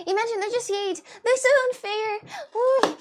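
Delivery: high, loud wail of unfairness; whining complaint. Acted. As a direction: wail like a hard-done-by child. Mock-tearful complaint after eating.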